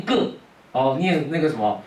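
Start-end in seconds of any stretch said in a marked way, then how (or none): 0.0-0.3 the character read as 个 instead of 路; wrong reading 个. Retold or imitated. Teacher quoting students who read the character as 个.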